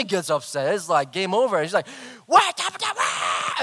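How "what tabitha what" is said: hammed-up shrieking at daughter; high, harsh mock shout; Virginia. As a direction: imitate a mother going crazy. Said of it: "she's, like, yelling at Tabitha".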